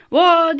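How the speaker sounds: high, loud "vaa" mocking the singer; exasperated imitation of shouty singing; imitated song yell. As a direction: mimic a singer bellowing in your ear. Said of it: "diye bağırıyor böyle".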